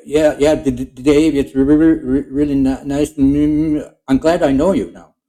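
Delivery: put-on high, loud stammering young man; nervous teen voice, tripping over every word; Baxter's stammer. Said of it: "He would say something like that".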